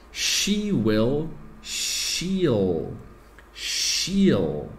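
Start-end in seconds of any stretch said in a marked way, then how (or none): 1.6-4.7 "she'll" said slowly as one syllable, like "sheel"; careful contraction demo. Shown as a demonstration. Teacher modelling "she'll" for students, slow and clear. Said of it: "presta atenção na minha pronúncia".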